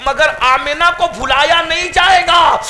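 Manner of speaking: Amina's words proclaimed in a fervent sermon cry; soaring preaching cry. Acted. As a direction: lift the mother's words to a triumphant shout. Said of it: "لیکن کہتی ہے".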